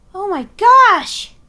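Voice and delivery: slow, loud astonished exclamation; stunned and amazed; surprised gasp. Acted. Character sees the eaglets.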